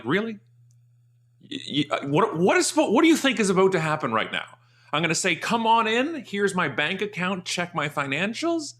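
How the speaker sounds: high incredulous mocking retort; a homeowner in his robe mocking a salesman, incredulous; sarcastic disbelief. To the door salesman, high incredulous sarcasm.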